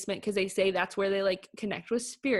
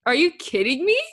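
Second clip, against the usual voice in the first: higher, louder, rising disbelief; incredulous; 4 semitones higher, 6 dB louder than usual. Real, not acted. Telling co-host about him doing laundry, incredulous. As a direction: punch it out as an incredulous question.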